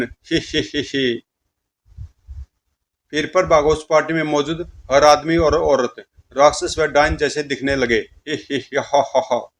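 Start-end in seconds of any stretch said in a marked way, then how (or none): 0.0-1.2 soft, drawn-out possessed giggle; slow eerie giggle. Acted. Possessed bride giggles at her mother-in-law, slow and eerie. 8.3-9.5 mad laughter. Acted. Possessed bride laughs madly at the party guests.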